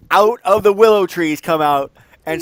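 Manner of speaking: slow, high, booming narration; loud announcement. Pretend. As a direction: call it out loudly over the chatter.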